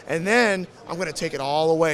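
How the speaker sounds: ordinary talk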